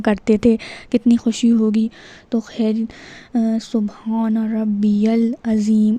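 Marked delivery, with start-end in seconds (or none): 3.6-6.0 slow, careful سبحان ربی العظیم; correct recitation. Shown as a demonstration. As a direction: model سبحان ربی العظیم with "rabbi-yal" drawn out. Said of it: "اس طرح سے پڑھیں".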